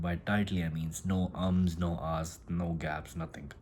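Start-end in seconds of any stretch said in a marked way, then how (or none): none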